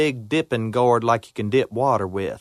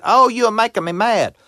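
mad granny; 7 semitones higher, 3 dB louder than usual. Acted. Granny scolding the gourd.

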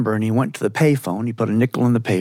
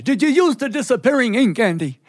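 high New York Yiddish questioning; eager New York Yiddish uncle; New York Yiddish accent; 10 semitones higher than usual. Acted. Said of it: "that New York Yiddish accent".

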